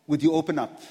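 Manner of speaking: ordinary talk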